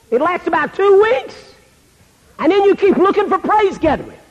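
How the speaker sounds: high-pitched scolding; disgusted. Acted. Jesus scolding a kid over broken commitments, disgusted. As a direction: list the failings in a high, disgusted voice. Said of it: "would be the word disgust".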